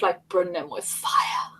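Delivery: hushed mock warning whisper; whispered aside. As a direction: drop to a breathy whisper.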